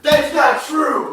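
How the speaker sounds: loud, high, drawn-out courtroom outburst; outraged protest. Acted. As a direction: shout down the testimony in mock outrage. Accused protests testimony.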